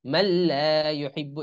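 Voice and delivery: মাল্লা ইউহিব্বু slow, lam doubled; slow tajweed recitation. Shown as a demonstration. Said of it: "কেউ মানলা পড়া যাবে না".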